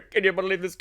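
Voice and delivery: high breathy laugh into the line; laughing quote. Real, not acted. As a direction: laugh while asking it. Laughing while quoting.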